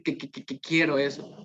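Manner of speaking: que, que, que repeated before quiero; stutter demo. Shown as a demonstration. Teacher imitates a stuttering child, soft repeated que. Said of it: "Cuando dice".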